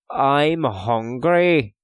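slow, loud, drawn-out moan; complaining. A narrator's reading. Owen complains suddenly of hunger, drawn-out grumble. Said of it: "complained Owen suddenly".

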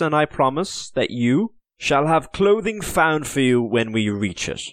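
feigned regret, polished and careful; smooth English officer. Acted. Henry's lying promises.

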